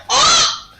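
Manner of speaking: high loud startled yelp; mock yelp. Romeo yelping as poison tips in. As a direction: let out a sudden choked cry.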